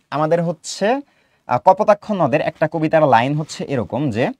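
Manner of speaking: ordinary talk, nothing marked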